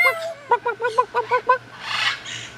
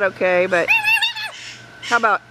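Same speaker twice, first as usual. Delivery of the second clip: ordinary talk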